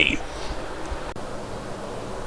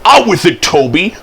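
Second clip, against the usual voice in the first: high, louder burst of impatience; impatient interruption; 7 semitones higher, 4 dB louder than usual. A narrator's reading. Thomas interrupts Toby's news, high and impatient. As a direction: cut in, urging him to get on. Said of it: "Thomas interrupted".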